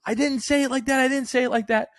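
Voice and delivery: high, loud flustered denial; flustered wife. Retold or imitated. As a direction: squeal it out, all defensive. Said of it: "And she goes".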